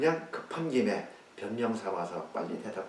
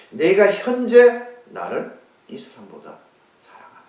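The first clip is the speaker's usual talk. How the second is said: emphatic question; 8 semitones higher, 17 dB louder than usual. Acted. Jesus asks Peter, emphatic.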